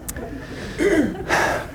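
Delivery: long unvoiced sigh; exasperated sigh before going on. Real, not acted. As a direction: let out a long breathy sigh. Breathy sigh amid sermon.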